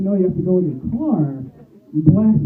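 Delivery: normal talking voice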